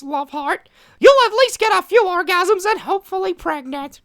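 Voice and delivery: high falsetto mockery of a tweet; squeaky cartoon voice. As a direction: read it like a squeaky Disney mouse. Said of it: "we should have done Mickey Mouse".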